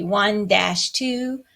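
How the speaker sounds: slow, even count with the dash held; teacher slowly counting one dash two, three dash four; counting the beats. Shown as a demonstration. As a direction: count it out slowly, in strict time. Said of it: "you would count it".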